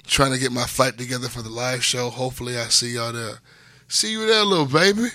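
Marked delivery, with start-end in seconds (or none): 3.9-5.1 put-on high cooing baby-talk voice. Host signing off, cooing baby talk to a listener. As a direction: coo it sweetly like to an infant.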